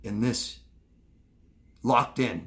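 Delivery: ordinary delivery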